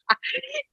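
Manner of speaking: short high laugh breaking out; light laugh at herself; quick amused giggle; real laugh. Real, not acted. Laughs at her own tantrum.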